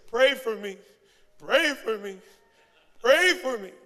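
loud groan shaped into words; mock wail, begging for prayer; pained plea for prayer. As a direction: imitate someone hurting, each plea a cry. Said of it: "what we really hear is".

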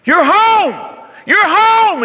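high, loud, overjoyed calling out; father's ecstatic cry of welcome; joyful shout. Acted. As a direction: shout it across the field to a returning son. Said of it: "he's probably shouting".

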